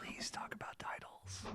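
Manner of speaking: hushed pleading whisper. Real, not acted. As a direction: whisper it, begging. Pleading host to Tony.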